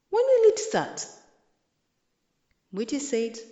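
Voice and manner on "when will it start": much higher, louder, eager question. A narrator's reading. Kyundu asks Mwiti eagerly when the feast starts. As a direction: ask like a kid who can't wait.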